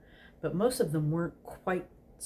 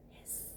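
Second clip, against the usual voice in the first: a soft snake hiss; whispered hiss; 23 dB quieter than usual. Acted. Snakes in the temple floor, a soft hiss.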